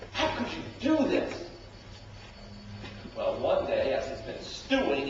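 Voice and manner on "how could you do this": quick, high accusing cry; preacher voicing the hurt son; wounded son. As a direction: fire the question at his father. Son's accusing quote.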